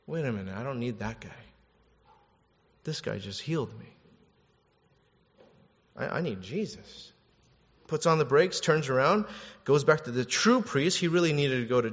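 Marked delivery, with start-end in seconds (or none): 0.1-7.1 soft, halting voice; dawning realization. Acted. Leper recognizes the true priest, soft dawning realization. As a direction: think it out softly, pausing as it sinks in.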